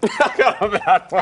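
laughing through the words; giggling while grossed out. Real, not acted. Laughs after tasting bad bean.

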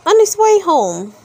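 ordinary delivery

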